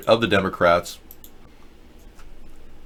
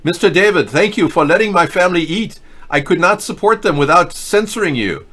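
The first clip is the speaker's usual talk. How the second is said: higher, louder mocking quote of a paid critic; mocking Reddit guy; 8 semitones higher, 4 dB louder than usual. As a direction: squeak it out in a higher voice as a mock plea. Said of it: "And he said".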